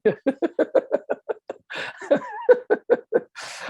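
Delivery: big high-pitched laugh; high burst of laughter. Real, not acted. Laughs at his MacBook.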